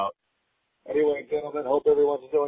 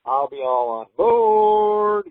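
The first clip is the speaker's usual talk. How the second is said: drawn out; 9 semitones higher, 5 dB louder, much slower than usual. Real, not acted. Emphatic drawn-out agreement.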